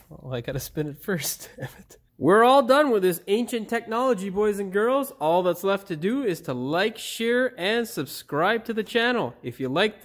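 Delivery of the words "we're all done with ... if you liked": loud, higher-pitched host sign-off in outro; video presenter. Acted. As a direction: sell the sign-off with punchy emphasis. Recording the scripted video outro.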